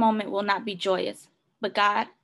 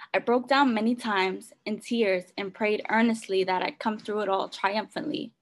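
usual speaking voice

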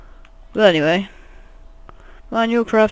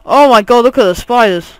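loud, higher-pitched alarm; raised, startled voice; startled exclamation; 4 semitones higher, 9 dB louder than usual. Real, not acted. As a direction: cry out at a sudden find. Spots spiders in the game.

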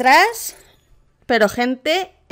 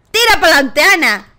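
loud, pushing call to a teammate; urgent shout; 3 semitones higher, 5 dB louder than usual. Real, not acted. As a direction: yell at Ana to get moving. Urging teammate Ana forward.

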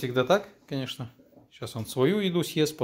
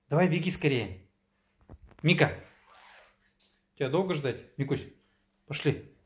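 talking to a dog; 3 semitones higher than usual. Real, not acted. Owner calling the dog to eat.

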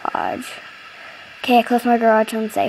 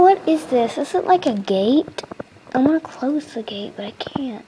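ordinary delivery

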